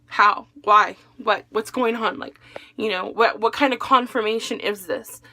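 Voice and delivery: panicked outburst. Retold or imitated. Shocked questions after hearing about accident.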